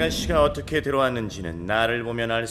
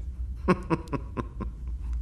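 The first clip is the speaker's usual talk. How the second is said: scornful laugh; 5 semitones higher, 10 dB quieter than usual. Acted. Dark lord mocks the elders.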